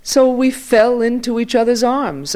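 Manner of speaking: high, loud rush of emotion; excited retelling. A narrator's reading. Elderly journalist telling of reunion with lost friend, excited. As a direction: burst out with it, pitch held high.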